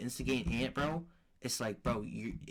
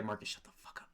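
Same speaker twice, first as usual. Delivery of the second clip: softer whispered scold; hissed whisper; 7 dB quieter, faster than usual. Retold or imitated. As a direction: whisper it sharply at a sibling. Retold hushed scolding of brother.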